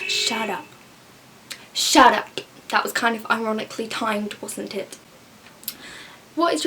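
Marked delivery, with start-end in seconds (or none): 0.1-0.7 short dismissive put-down at normal volume; curt retort. Acted. Answering critics of not eating meat, curt retort. Retort to people questioning vegetarians. 1.7-2.3 much louder, exasperated burst; loud retort. Acted. Silencing whoever interrupts the rant, loud retort.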